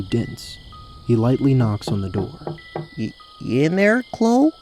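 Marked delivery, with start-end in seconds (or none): 3.0-4.5 high, halting call with rising end; unsure, questioning character voice; tentative Rael. Acted. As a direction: call uncertainly through a door. Rael calls tentatively at door.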